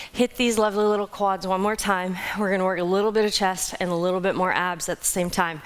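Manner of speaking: usual speaking voice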